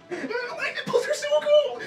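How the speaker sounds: teeth chattering. Real, not acted. Freezing in ice bath.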